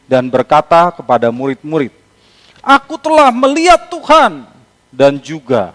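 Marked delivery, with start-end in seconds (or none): 2.6-4.5 excited announcement. Acted. Maria announces to disciples.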